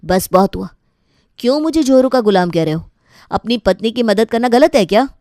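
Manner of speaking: loud angry snap; husband snapping at his family, loud; angry outburst. Acted. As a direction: finally lose patience. Husband finally snaps.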